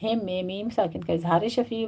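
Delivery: normal talking voice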